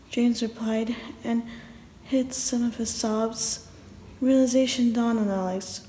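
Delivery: ordinary delivery, nothing marked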